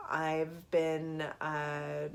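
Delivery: ordinary talk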